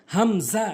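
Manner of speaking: "হামজা" slow, syllables drawn out; correct pronunciation. Shown as a demonstration. Teacher models the Arabic letter, slow "হামজা". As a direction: stretch "হামজা" as ham-zaa. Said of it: "সঠিক উচ্চারণ".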